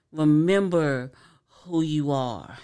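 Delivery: slow, drawn-out Rafiki line; lightly voiced wise old Rafiki; Rafiki quote. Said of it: "in The Lion King".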